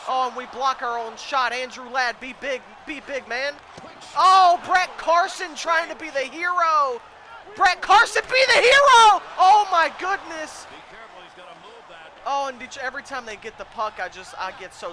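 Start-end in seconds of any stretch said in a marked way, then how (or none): 4.2-10.7 high, loud shouts building to a peak; huge excited commentator yell; excited shouting. Real, not acted. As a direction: roar the player's name, then ease off slowly. Excited at player's chance.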